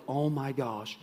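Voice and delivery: hushed, understated dread; soft mock-awed fright; retold terror. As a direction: imitate terrified men speaking low and soft. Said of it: "They were terrified".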